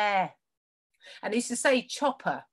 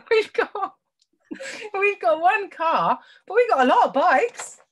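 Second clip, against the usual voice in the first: much higher, giggling as she explains; laughing through words; 9 semitones higher, 3 dB louder than usual. Real, not acted. To her quiz host, explaining her answer while giggling.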